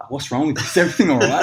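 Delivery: a patient alarmed by sudden coughing; concerned patient. Retold or imitated. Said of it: "She's like".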